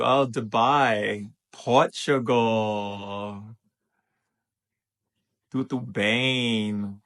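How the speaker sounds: long, stretched-out shout-outs; greeting call. Real, not acted. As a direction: call out slowly, holding the last vowels. Greeting viewers' countries in turn.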